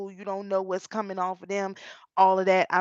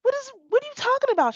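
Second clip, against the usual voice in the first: startled; 5 dB louder, faster than usual. Retold or imitated. Startled reply to daughter.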